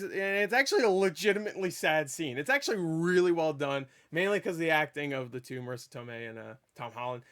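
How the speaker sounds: usual speaking voice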